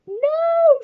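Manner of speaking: very high, long loud wail; horrified cry. A narrator's reading. Trunchbull gasps at Magnus's name, crying out. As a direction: cry out in shocked denial. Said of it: "she cried".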